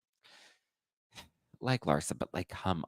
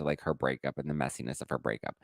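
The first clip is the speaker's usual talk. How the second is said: usual speaking voice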